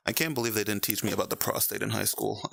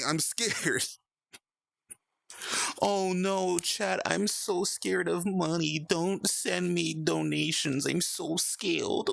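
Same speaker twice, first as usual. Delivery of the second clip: higher, slow hammed-up fright; theatrical fake fear, drawn out; mock scared; 8 semitones higher, much slower than usual. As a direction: play at being terrified of donations. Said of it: "I don't know where that came from".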